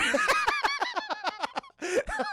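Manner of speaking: real high burst of laughter; long high-pitched laugh. Real, not acted. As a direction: crack up at the line. Teller laughs at the retold line.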